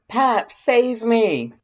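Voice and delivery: high, loud, slow yell for help; bellowing plea. A narrator's reading. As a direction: bellow it out to be rescued. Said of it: "you bellow".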